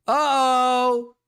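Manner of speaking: loud, high teasing uh-oh; a sing-song gotcha; mock uh-oh. Real, not acted. Teasing reaction to Cal.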